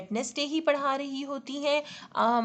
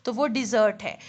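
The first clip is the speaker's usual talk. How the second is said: usual speaking voice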